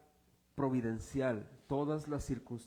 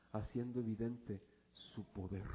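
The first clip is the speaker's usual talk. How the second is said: usual speaking voice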